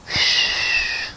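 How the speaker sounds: soft whooshing breath of wind; little breeze sound. Shown as a demonstration. Reader showing children a little breeze, soft whoosh. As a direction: blow a long, gentle shhh. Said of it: "can you make a little breeze sound".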